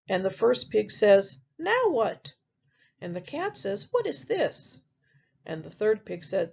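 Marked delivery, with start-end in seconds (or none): 1.6-2.3 high pig voice. Acted. First pig voiced in story. 3.9-4.8 high cat voice. Acted.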